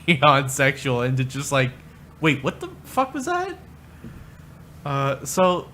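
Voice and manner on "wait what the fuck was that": high-pitched startled double take; mock bewilderment. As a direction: act out a baffled, high-voiced reaction. Retold startled reaction to sex talk.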